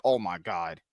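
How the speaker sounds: low mock groan of dismay; imitating shocked disbelief. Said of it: "it was like".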